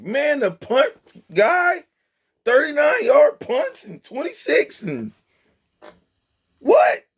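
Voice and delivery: very high, disbelieving rant; high-pitched outraged astonishment; incredulous. Real, not acted. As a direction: squeal it in disbelief. Incredulous rant about the punts.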